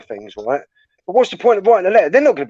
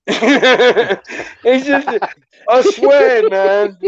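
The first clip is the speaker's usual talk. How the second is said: higher, laughing protest; 6 semitones higher, much slower than usual. Real, not acted. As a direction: laugh while protesting the joke. Laughing at Philip's joke.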